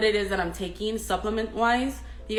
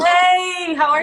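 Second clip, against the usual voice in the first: much higher and louder greeting; shouted hey; 10 semitones higher, 13 dB louder, slower than usual. Real, not acted. Welcoming the guest onto her live with a shout. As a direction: call out happily to the guest.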